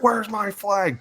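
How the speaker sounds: very high mock protest; hammed-up plea in a high voice; complaining tight end. Tight end demanding his flag, mock outraged complaint. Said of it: "He's like".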